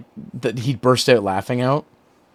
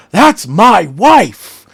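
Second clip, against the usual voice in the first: slow, loud, high drawn-out exclamation; hammed-up outraged yell; imitating the meme clip; 10 semitones higher, 12 dB louder, much slower than usual. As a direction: stretch it out like a shocked meme. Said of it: "the two ones we use".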